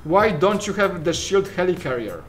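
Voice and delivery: ordinary delivery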